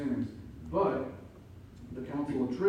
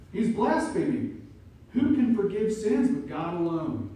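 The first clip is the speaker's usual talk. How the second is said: louder, higher outraged scribes; indignant accusation; 4 semitones higher, 6 dB louder than usual. Acted. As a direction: accuse him of blasphemy.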